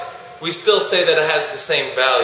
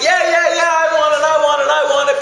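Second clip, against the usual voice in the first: much higher, louder mock crowd shout; excited kids shouting; 13 semitones higher, 4 dB louder than usual. Students begging the speaker for the twenty: high excited shouts. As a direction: yell it like kids desperate for the cash.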